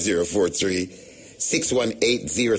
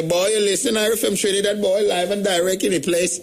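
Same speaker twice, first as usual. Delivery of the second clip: higher, Trinidadian DJ voice; 11 semitones higher than usual. Retold or imitated. Host imitating a Trinidad radio DJ, high and Trinidadian. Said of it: "and say".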